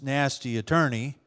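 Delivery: ordinary delivery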